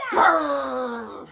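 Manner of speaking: loud shouted mock roar; dragon roar. Dragon roaring at the townspeople, loud shouted roar. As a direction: roar right back at them. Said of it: "said the Little Green Dragon".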